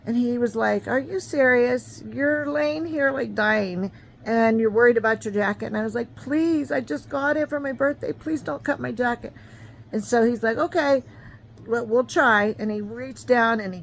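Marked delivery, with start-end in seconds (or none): 0.9-5.7 can't believe what he's hearing; incredulous. Retold or imitated. EMT incredulous at her. 6.2-9.3 high begging whine; pleading. Retold or imitated. Girl begging the medic again over her birthday jacket, high pleading. 10.6-12.6 humoring. Retold or imitated. EMT humoring her.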